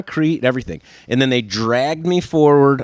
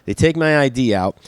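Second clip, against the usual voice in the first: ordinary talk, nothing marked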